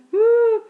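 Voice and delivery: loud high "ooh" wail; mock howl. Kids in the hall hear a loud howling wail. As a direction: howl it out like a child being punished. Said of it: "and the".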